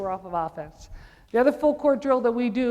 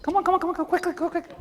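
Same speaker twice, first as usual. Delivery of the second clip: loud, high hurry-up yelling; urgent shout; 8 semitones higher, 4 dB louder, much faster than usual. Real, not acted. Coach hurrying players through the drill with a high shout. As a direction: rush them along.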